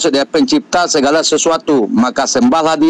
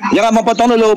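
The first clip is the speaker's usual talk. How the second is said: insistent protest; 7 semitones higher than usual. Real, not acted. Protesting being interrupted.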